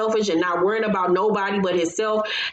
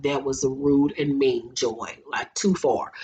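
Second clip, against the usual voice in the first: low, slower scolding; disapproving; 6 semitones lower, slower than usual. Retold or imitated. Reacting to Joy's insult, low disapproval. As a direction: scold with a dropping voice.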